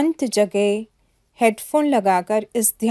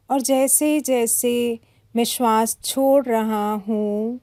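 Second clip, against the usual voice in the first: guided meditation. Acted. Yoga teacher guides listeners to relax body and breath, calm meditation voice.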